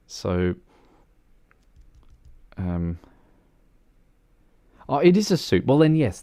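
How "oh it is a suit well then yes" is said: higher and louder, caught off guard; a bright oh as it clicks; sudden realization. Real, not acted. Streamer realizing it is a suit, surprised.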